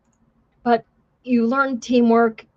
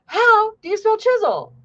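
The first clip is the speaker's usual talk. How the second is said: much higher, drill-instructor bark; yelling instructor; 11 semitones higher than usual. Retold or imitated. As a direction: call the recruit out like a drill instructor. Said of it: "the instructors yell at Contreras".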